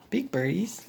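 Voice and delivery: usual speaking voice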